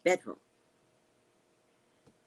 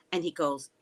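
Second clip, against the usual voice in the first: ordinary talk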